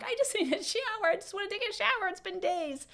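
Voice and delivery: a frantic retold plea, half laughing at herself; desperate panic. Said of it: "I was panicking 'cause I'm like".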